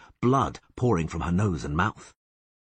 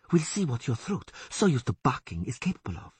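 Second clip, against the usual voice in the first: higher, mocking elf woman hissing; 7 semitones higher than usual. A narrator's reading. Elf woman taunting the bound Witcher, higher sneer. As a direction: taunt the bound man, pitched up. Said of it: "she hissed".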